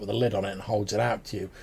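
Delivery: normal talking voice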